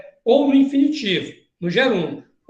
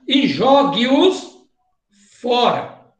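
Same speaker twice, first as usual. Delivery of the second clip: slow emphasis; much slower than usual. Shown as a demonstration. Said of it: "preste atenção".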